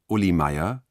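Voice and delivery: ordinary talk, nothing marked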